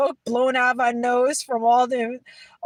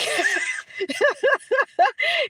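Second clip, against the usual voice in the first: high rippling giggle; real laughter; 13 semitones higher than usual. Real, not acted. New Yorker laughing about her first accent, real laughter. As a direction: burst out giggling.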